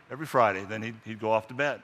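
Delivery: ordinary talk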